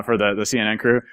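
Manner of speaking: ordinary talk, nothing marked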